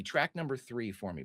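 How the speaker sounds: ordinary talk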